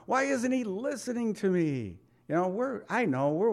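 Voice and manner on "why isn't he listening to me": high, soft, plaintive questions of a hurt wife; preacher voicing a hurt wife, high and plaintive; wife's pleading thoughts. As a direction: ask it like a wife who feels unheard. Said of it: "women will look at us and think".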